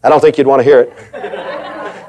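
ordinary delivery

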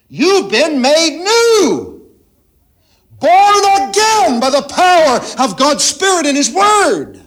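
high, loud, slow proclamation; preaching shout. Real, not acted. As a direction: cry it out to the congregation. Preacher peaks on the new birth.

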